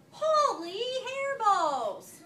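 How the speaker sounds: very high, loud, slow exclamation; exclaimed. A narrator's reading. Cat boy amazed at his own head, loud drawn-out cry. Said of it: "exclaimed Skippy John Jones".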